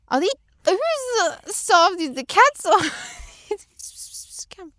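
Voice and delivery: high, loud pet-talk voice. Real, not acted. Cooing high and loud at her aunt's cats. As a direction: coo at the cats playfully.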